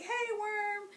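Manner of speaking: put-on tiny girl's hello to a worm; kid greeting. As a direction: coo to the worm like a small child. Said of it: "I'd be like".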